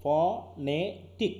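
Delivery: very slow phonetic, each syllable pulled apart; drawn out syllable by syllable. Shown as a demonstration. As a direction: stretch out phonetic slowly, one syllable at a time. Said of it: "কিন্তু আসলে কি?". (ধীরে ধীরে syllable আলাদা করে phonetic)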